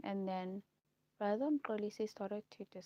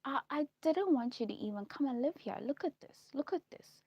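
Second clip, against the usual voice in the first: high, loud, imitated disapproving dad; scolding father; 7 semitones higher, 8 dB louder than usual. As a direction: play a dad objecting to where his daughter lives. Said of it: "And, and he says".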